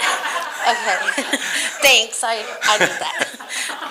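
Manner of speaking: laughing through the words, slowed down; nervous laughter. Real, not acted. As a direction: giggle nervously while speaking. Nervous speaker laughing at her mentor.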